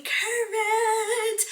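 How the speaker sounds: high, drawn-out singsong on one held note; singsong exclamation. Real, not acted. Vlogger promising viewers up-to-date videos, singsong excitement. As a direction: stretch it out high in gleeful celebration.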